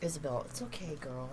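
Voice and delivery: soft, low comfort to the dog; softly reassuring a worried pet; soothing the dog. Real, not acted. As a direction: hush the dog gently. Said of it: "soothing the dog".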